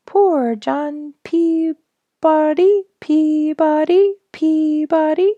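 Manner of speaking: high, slow whistled birdsong; sparrow song. A narrator's reading. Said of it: "his plaintive sweet".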